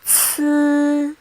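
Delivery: Ci held high and level; first tone. Shown as a demonstration. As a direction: say Ci on one steady high note. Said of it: "this is how we mark the tones".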